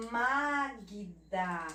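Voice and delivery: Magda drawn out as "Ma-gui-da"; "Magda" in three careful syllables; added vowel. Shown as a demonstration. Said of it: "a mesma coisa do magnífico".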